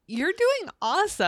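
high, loud encouragement; cheering on. Retold or imitated. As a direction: hype him up. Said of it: "He's like".

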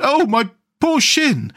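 high, drawn-out wail of pain; pained cry. A narrator's reading. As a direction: wail slowly over a hurt. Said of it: "he cried".

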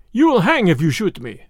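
ordinary delivery, nothing marked

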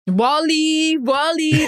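David Ortiz impression. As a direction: call out Wally like a slugger, a light imitation. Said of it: "That's my David Ortiz with the Wally".

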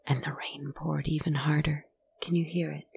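hushed, breathy storyteller whisper. A narrator's reading. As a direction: draw the listeners in with a whisper. Eerie whisper in ghost story.

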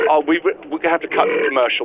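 normal talking voice

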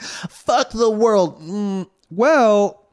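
slow, stretched mock rebellion; drawn-out teen angst. Friend mocks teenage poets, slow sulky rebellion. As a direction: drag it out like a sulky thirteen-year-old. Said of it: "being like".

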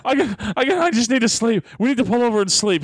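high-pitched, overdone mock complaint; dramatic exhausted whining. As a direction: imitate a worn-out friend, hammed up and high. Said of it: "He's so dramatic with it".

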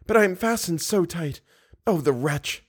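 high, despairing captive voice; close to sobbing. A narrator's reading. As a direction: lament it in a high voice, near a sob. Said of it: "she gave a sob".

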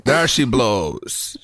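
high, slow, hammed-up old-film lady; Katharine Hepburn impression. Answering the cohost's impression, slow high hammy Hepburn voice. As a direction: draw it out like a grand old screen actress. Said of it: "thought that was Katharine Hepburn".

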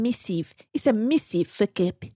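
missive with a short i, Kiwi style; lightly done Antipodean accent; Australian accent that slides Kiwi; New Zealand-ish Australian. Retold or imitated. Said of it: "I go to New Zealander for my Australian".

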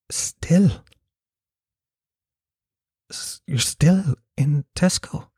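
hushed, slow incredulous question; breathy mock concern, rising in disbelief. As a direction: imitate her stunned disbelief, half-whispered and drawn out. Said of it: "clouded over with just sort of concern mixed with disbelief".

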